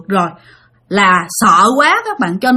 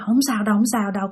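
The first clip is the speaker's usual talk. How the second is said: quick, hushed self-soothing; self-reassurance; 6 dB quieter, much faster than usual. Retold or imitated. Frightened sleeper reassuring themselves before lying back down, hushed and quick. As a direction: murmur it fast to calm yourself. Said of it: "tự trấn an mình".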